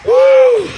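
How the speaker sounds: very high, loud whoop; exultant whoop. Real, not acted. Preacher at the peak of revival, exultant whoop. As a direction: let out a piercing revival whoop.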